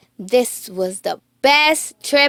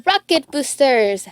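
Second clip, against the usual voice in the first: Riley voice; 8 semitones higher than usual. A narrator's reading. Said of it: "said Riley".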